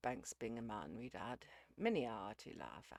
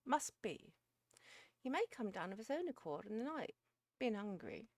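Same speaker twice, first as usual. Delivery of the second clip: high, raised voice, resigned to it; philosophical; 7 semitones higher, 6 dB louder than usual. A narrator's reading. Missus Beale reasons about the cat, philosophical. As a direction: pronounce it as homely wisdom, pitched up. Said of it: "added Missus Beale philosophically".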